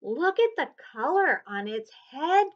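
high, loud eager call; cried out. A narrator's reading. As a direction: point and cry out. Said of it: "cried Marta".

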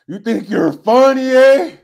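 loud homeboy challenge, rising "eh?"; mock confrontation, building to a yell; mock angry challenge. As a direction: pretend to take offence, loudly. Mock confrontation of a teasing viewer.

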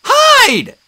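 high, loud shrill yell. A narrator's reading. Tree nymph yelling warning at approaching scorpion, loud. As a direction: shout the warning. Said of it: "Juniper yelled".

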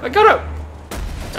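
high breathless triumphant squeal; shrieking jackpot winner. Real, not acted. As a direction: squeal it out in disbelief. Finally got the item.

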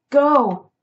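very loud, high, forceful command; full shout. A narrator's reading. Choking cat driving off closing dogs, full shout. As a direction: shout it with all remaining strength. Second, louder order at the dogs.

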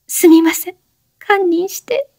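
high, trailing teary apology; crying high voice; weeping apology. A narrator's reading. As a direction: fade into sobs. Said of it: "激しく泣きながら".

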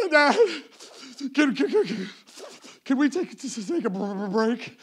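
loud, high sobbing stutter; mock hiccuping cry. Daughter asking dad for a break, crying too hard to talk. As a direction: gasp the words out between fake sobs. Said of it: "one of those cries that you couldn't talk".

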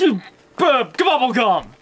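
normal talking voice

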